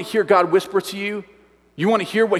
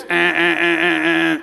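rapid series of identical honks; air horn honks; 3 dB louder than usual. Retold or imitated. Camp counselor demonstrating the last signal, imitated air horn. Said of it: "They get out the air horn".

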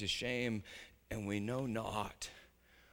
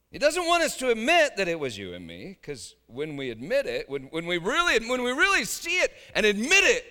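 louder and higher, building to a peak; rising fervor; 8 semitones higher, 9 dB louder than usual. Real, not acted. Preacher pressing his flock to admit it, building fervor. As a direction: drive it home with growing force.

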